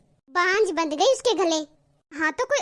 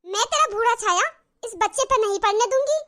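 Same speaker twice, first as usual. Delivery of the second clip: higher, sharp scolding mother-in-law; harsh scolding; 4 semitones higher than usual. Acted. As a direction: warn her off the baby, spitting the words. Mother-in-law scolds the childless elder daughter-in-law.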